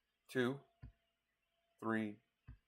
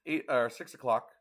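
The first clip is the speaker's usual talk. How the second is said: ordinary delivery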